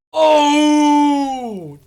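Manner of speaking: loud surprised oh. Real, not acted. Reacting to harsh chat comment.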